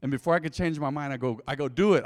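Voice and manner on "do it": loud, urgent retold order; urgent command. Man orders friend to shave his head, urgent command. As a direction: punch it out before nerve fails.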